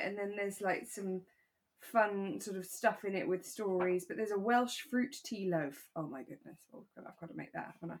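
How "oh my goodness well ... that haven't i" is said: near-whispered aside to herself, much softer; hushed aside. Real, not acted. Co-host murmuring to herself about baking the loaf, hushed aside. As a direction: drop to a breathy private murmur.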